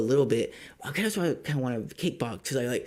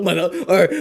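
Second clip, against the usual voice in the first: higher, louder laughing rant; laughing in disbelief; 6 semitones higher, 10 dB louder than usual. Real, not acted. Mocking the scammer's bad English, laughing loudly. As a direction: laugh through the outrage. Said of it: "I'm just getting so emotional".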